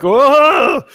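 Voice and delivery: high, loud cry of alarm; startled bartender yelp. Acted. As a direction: yelp as if yanked off your feet. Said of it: "The bartenders were like".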